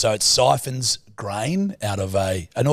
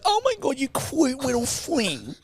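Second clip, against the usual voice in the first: high, slow gushing coo; mock cooing girlfriend; 14 semitones higher, 5 dB quieter than usual. Imitating a girlfriend gushing at the tiny excavator, mock coo. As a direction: coo over something adorable. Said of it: "And she's like".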